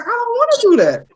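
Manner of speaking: squeaky put-on protest; high mock voice. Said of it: "He's like".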